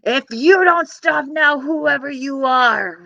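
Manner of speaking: loud, slow giant threat; roaring giant. Acted. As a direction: roar it down the hill. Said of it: "roared the giant".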